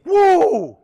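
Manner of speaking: loud high whoop of victory; excited whoop. Real, not acted. Fan whooping for his winning team. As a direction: cheer it out.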